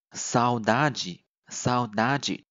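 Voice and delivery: saudade slowly, ending in "djee"; Brazilian G sound. Shown as a demonstration. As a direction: model saudade twice, final de as "jee". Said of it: "它就发成G的音". (慢读saudade，词尾de发“ji”)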